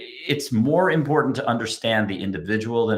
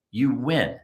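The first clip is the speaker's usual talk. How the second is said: ordinary talk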